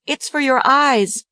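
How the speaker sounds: put-on high, slow child voice; earnest child. A narrator's reading. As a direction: say it sweetly like a child.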